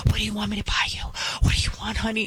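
put-on whispered, coaxing a spoiled child; doting whisper. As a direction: whisper a bribe to a whiny kid. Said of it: "the mom is like".

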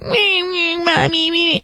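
put-on high, whiny little-boy whimper; mock crying child. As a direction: imitate a bratty kid whining for mommy. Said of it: "This little boy was crying".

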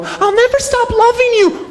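very high, slow mock-tragic falsetto; melodramatic princess. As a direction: swoon it out like a dying movie heroine. Princess slipping from rope bridge.

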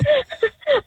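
real burst of laughter; real relieved laugh. Real, not acted. Relieved after prank reveal.

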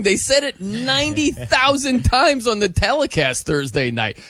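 ordinary delivery

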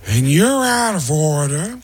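slow Pacino voice, weight on you're; Al Pacino impression. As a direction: drag it out, leaning on you're. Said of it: "they cut to me as Al Pacino".

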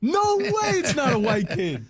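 high, emphatic disbelief; no way, exaggerated; mock disbelief. Real, not acted.

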